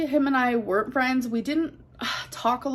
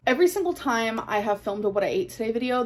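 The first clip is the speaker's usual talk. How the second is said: ordinary talk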